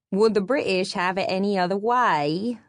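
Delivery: a lightly put-on stodgy English accent; mock the British with a poor English accent; hint of a pitiful English accent. A narrator's reading. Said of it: "attempt at a stodgy English accent".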